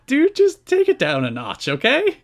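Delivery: high, loud mocking plea; squeaky scolding voice; mock exasperated friend. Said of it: "I was like".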